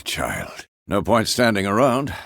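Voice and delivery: ordinary delivery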